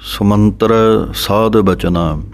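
ਸੁਮੰਤਰ with a short u on ਸ; pronunciation demo. Shown as a demonstration. Teacher showing students how to read ਸੁਮੰਤਰ. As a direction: say ਸੁਮੰਤਰ as su-man-tar, u kept short. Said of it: "ਸੁਮੰਤਰ ਇਸ ਤਰ੍ਹਾਂ ਪੜ੍ਹਨਾ ਹੈ".

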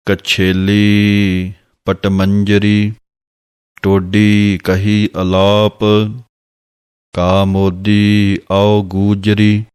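slow half-sung gurbani line; slow devotional chant; slow recitation. Shown as a demonstration. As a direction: chant each phrase slowly and evenly. Recites text, partly sung.

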